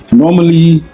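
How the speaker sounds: showing how he talks to people in Ghana, Ghanaian English accent. Shown as a demonstration. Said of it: "I'll be speaking like this to people".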